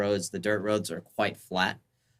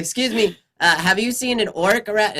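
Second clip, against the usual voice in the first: high, raised little gnome voice; 12 semitones higher, 8 dB louder than usual. Acted. Gnome asks passersby about an orc, high and loud. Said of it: "And he's like".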